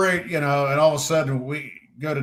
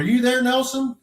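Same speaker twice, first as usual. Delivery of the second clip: high-pitched questioning call; calling over; 12 semitones higher than usual. Real, not acted. Storyteller checking with Nelson, high calling question. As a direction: lift the pitch to ask someone. Calls to another listener.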